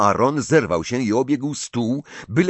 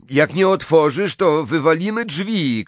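high, raised-voice ultimatum; loud, pitched-up warning; shouted threat; 9 semitones higher, 4 dB louder than usual. A narrator's reading. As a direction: shout it through a locked door. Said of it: "krzyknął Harry".

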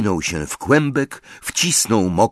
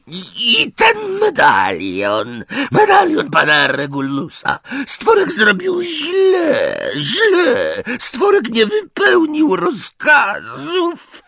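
much higher, loud and slow wail; wild elf scream; 5 dB louder, slower than usual. A narrator's reading. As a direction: shriek in despair like a frantic elf. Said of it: "wrzasnął dziko".

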